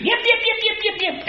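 high thin yaps like a little dog; squeaky little dog yips, soft; dog yipping. Retold or imitated. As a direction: yap like a tiny lapdog. Imitating yapping dog Dixie.

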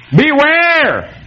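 Beware! called out high, as on a warning sign; exclaimed warning. Shown as a demonstration. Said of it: "Do you need an exclamation point?".

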